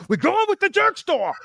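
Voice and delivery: over-the-top mock insistence; squeaky mock George. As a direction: squeal it like a stubborn kid. Mocking George's stubbornness.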